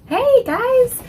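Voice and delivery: high, loud bright hello; excited greeting. Real, not acted.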